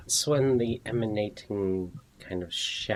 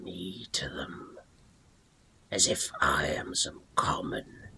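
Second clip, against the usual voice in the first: gravelly voice. Acted. Sword spirit shamed before its wielder's companions, gravelly whisper. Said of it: "the gravelly voice calls out".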